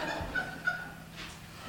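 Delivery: high breathy chuckle; breathy laugh. Real, not acted. Preacher laughing at a listener's quip, breathy chuckle. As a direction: laugh off the remark.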